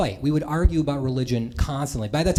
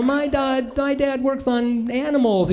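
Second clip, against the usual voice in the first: put-on higher, slow little-kid voice; 7 semitones higher, slower than usual. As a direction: chirp it like a six-year-old. Another kid introduces himself.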